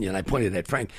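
ordinary talk, nothing marked

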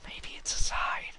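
whispered aside. Real, not acted. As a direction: whisper it to yourself. Aside after shouting death.

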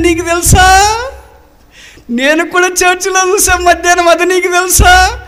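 laughing, shouting joy at finding each other; overjoyed reunion. Acted. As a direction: cry out as you recognise your lost spouse. Reunited couple recall the carpet.

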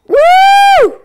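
high, loud whoop of joy; excited cheer. Real, not acted. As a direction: let out a big happy whoop. Cheering a teammate's arrival.

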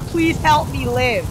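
loud, high, slow hammed-up begging; mock desperate plea. Player imagining their own house on fire, pretend desperate cry. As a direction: beg loudly as if trapped. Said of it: "I'm like".

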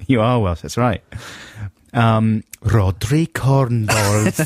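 a light mock Welsh accent, slowly drawn out; a hint of Welsh, stretched out slow. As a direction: say the name in a hammed-up Welsh lilt, very slow. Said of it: "That's my Welsh accent".

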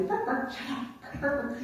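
retelling a dog barking during a stakeout; imitating barking. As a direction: bark like the dog, then whisper a hush. Dog barks, musher hushes.

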